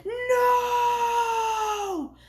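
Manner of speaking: high, loud scream of grief; anguished scream. Acted. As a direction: scream it out in despair. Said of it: "Haunted screams".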